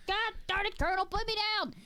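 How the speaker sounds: high whiny protest; high hammed-up whining; mock Ed protest. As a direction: whine at being carried. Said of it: "go like".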